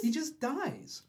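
high, drawn-out confirmation; slow, high-pitched repeat; incredulous echo. Real, not acted. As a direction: stretch it out high for comic effect. Echoes the shocked listener.

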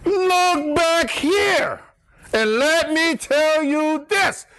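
high, very slow proclamation; Adam speaking. Retold or imitated. Adam slowly proclaiming to the women to look back. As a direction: draw it out like a grand announcement. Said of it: "'cause Adam goes".

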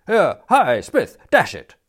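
high, loud shouting after someone; loud indignant call. A narrator's reading. Indignant youth calls after Smith, loudly. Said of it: "who called loudly".

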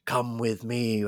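slow, drawn-out ghostly summons; Helen. Retold or imitated. Helen beckoning Trevor through the mirror, slow ghostly voice. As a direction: stretch each word like a hammed-up vengeful ghost.

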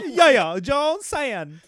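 high-pitched Southern twang; hammed-up Southern customer voice; mock Southern accent. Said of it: "Are you from the South?".

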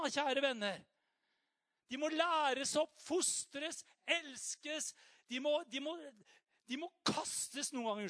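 loud, high fervent preaching; preaching at full force. Acted. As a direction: drive it home to the congregation.

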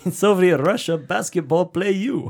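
put-on high, loud joke Russian accent; mock Russian accent. Riffing on Soviet Russia as a joke, mock Russian accent.

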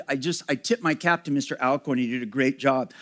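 ordinary talk, nothing marked

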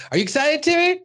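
high, loud teasing question; high sing-song taunt; teasing kid. Retold or imitated. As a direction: tease him in a cartoon kid voice. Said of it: "they go to Timmy".

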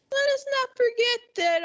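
very high, pointed sing-out reminder; mock reminder. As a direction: pitch it way up, ironically admonishing. Mocking reminder after imitated American outrage.

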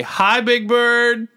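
high, drawn-out singsong hello; cheery greeting. Retold or imitated. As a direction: call out a sweet, slow hello. Calling a greeting to Big Bird.

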